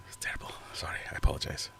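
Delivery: whispered, embarrassed apology. Real, not acted. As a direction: hush it out sheepishly. Embarrassed apology after accent.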